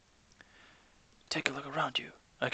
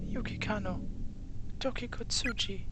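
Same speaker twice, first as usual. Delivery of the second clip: put-on higher, coaxing girl voice; gentle schoolgirl; 9 semitones higher than usual. Offering tongues to ghost girls.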